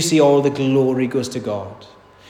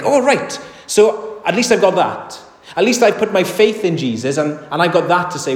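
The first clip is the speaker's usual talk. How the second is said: higher-pitched mock relief; higher, louder imagined objector; self-satisfied listener; 6 semitones higher, 4 dB louder than usual. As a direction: voice a listener pleased to have something to claim.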